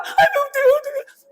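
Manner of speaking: high, loud mock cry of panic; mock panicked parent. Preacher imitates a panicking mother when her child falls, mock wail. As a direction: wail like a parent who saw the child fall. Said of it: "Por que que faz isso?".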